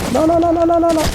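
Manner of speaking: high rapid panicked nos. Real, not acted. Gamer watching his fight go wrong, high panic. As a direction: yelp no in a rising panic.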